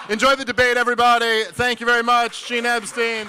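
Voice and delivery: high, loud emcee handoff; announcer sign-off. Acted.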